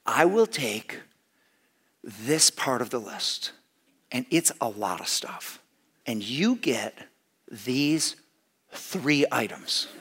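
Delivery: soft, slow, spaced-out phrases; patient explaining with long pauses; measured instructions. Retold or imitated. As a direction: lay it out piece by piece, quietly. Acted slow, careful instructions to wife.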